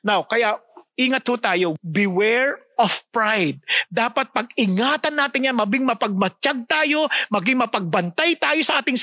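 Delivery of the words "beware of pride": slow and low, each word weighed; grave pulpit warning, drawn out; solemn slow warning. Acted. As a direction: lower your voice and warn them.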